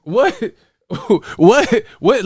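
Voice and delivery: high, baffled "what"; half laughing in disbelief; incredulous laughing. Real, not acted. Baffled laughter at chat nonsense.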